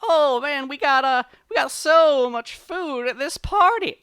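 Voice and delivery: high, loud excited party-goer; faked high-pitched gushing; put-on party voice. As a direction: brag loudly so the bear hears. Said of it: "Brer Rabbit says".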